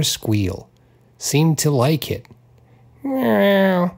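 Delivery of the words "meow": high, drawn-out cat meow; cat meow, only a little louder. Acted. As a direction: meow for attention. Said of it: "the cat said loudly".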